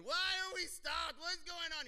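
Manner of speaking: hammed-up child; whiny kids. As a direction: whine like a stuck child. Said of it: "Your kids are going".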